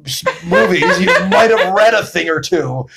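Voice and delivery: laughing high and loud. Real, not acted. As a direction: laugh through the words. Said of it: "I was being sarcastic".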